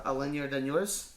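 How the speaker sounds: normal talking voice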